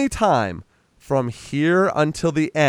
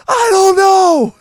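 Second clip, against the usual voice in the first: squeaky reply; 8 dB louder, slower than usual. Retold or imitated. Wife answers flustered husband.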